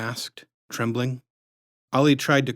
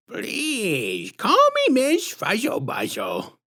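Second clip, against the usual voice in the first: high, slow sugary put-on voice, far above the narrator; sickly sweet menace, drawn out; syrupy sinister clown woman introducing herself; creepy clown lady; 11 semitones higher, much slower than usual. A narrator's reading.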